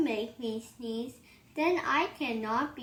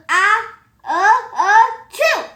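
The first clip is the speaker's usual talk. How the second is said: loud high rising gasps then chew; sneeze; 8 semitones higher, 13 dB louder than usual. Acted. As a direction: build and release a sneeze. Acted sneeze.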